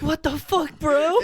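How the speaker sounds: high, loud mock outrage; outraged disbelief. Agreeing with his co-host, high loud mock outrage. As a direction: throw it out like a fan who can't believe it. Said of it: "It's like".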